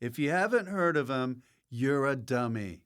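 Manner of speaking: ordinary delivery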